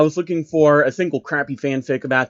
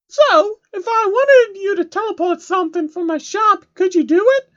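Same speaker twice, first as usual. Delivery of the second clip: hammed-up high lady pony; Rarity. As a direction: ask sweetly about your shop. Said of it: "Rarity asked".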